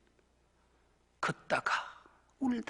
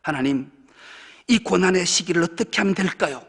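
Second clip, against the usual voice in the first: loud, higher, fervent crying-out prayer; earnest prayer; 5 semitones higher, 11 dB louder than usual. Shown as a demonstration. Believer praying loudly to God for strength, fervent. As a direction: cry out to God asking how, at full force. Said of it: "어떻게라고 물어야 돼요".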